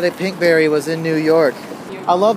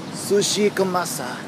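mock Japanese accent; 3 semitones higher, 6 dB quieter than usual. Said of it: "trying to say everything with a Japanese accent".

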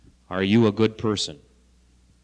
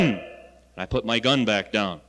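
normal talking voice

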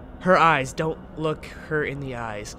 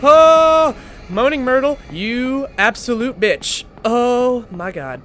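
high, loud cry easing into drawn-out cursing; shocked yelling; 7 semitones higher, 9 dB louder, slower than usual. Real, not acted. Player reeling from ghost scare, loud shocked cursing. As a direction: yell in shock, then slowly come down.

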